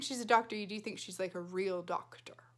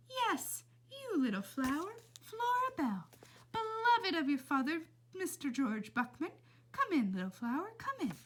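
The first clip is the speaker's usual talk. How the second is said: put-on old lady voice; 8 semitones higher than usual. A narrator's reading. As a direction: croon it like a sweet old lady. Old lady invites Flora in.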